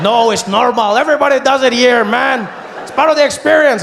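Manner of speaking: high, loud vendor voice; a high, loud vendor defending the custom; taco guy. Retold or imitated. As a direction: shrug it off like a cheerful vendor. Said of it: "I said".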